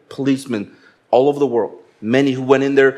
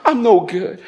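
mock self-pity; 6 semitones higher than usual. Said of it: "where you go".

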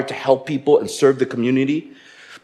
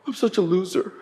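mock self-pity; 6 semitones higher than usual. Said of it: "where you go".

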